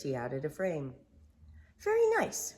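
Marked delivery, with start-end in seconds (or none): 1.8-2.5 high, pleased fussy rabbit. Acted. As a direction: admire it in a prim high voice. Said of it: "Rabbit said".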